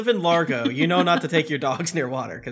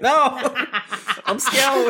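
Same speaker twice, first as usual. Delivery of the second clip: much higher mock whine; mock scared kid; 8 semitones higher than usual. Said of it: "I'm like".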